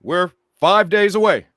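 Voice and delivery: hint of Obama speech cadence; Obama impression. Shown as a demonstration. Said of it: "how do I do it?".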